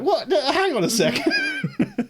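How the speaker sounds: high, slow, startled double take; mock surprise. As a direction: pull up short in disbelief. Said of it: "I'm like".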